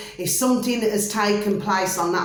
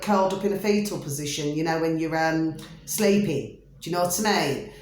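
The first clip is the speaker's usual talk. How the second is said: ordinary talk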